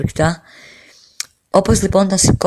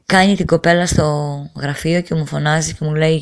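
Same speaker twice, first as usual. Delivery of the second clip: ordinary delivery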